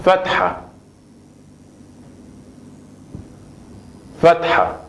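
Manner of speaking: fatha said the Arabic way, fat-ha; name the vowel fatha carefully; Arabic word fatha. Shown as a demonstration. Teacher naming the Arabic vowel, careful fatha. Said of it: "La primera vocal es".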